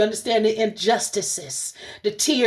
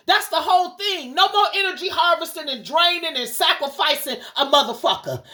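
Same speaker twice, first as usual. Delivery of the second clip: high, fervent preacher's rebuke, pitched well up; preaching rebuke; 8 semitones higher than usual. Real, not acted. To the collective, rebuking Satan and covens in a raised preaching voice. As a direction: cast them out like a preacher at full force.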